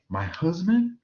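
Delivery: high-pitched incredulous question; acted disbelief, pitch leaping up. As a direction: ask it as if you can't believe it. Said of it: "in a state of disbelief".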